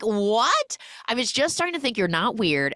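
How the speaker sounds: high-pitched mock disbelief; incredulous. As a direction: react in retold outraged astonishment. Retold woman hears he has no phone.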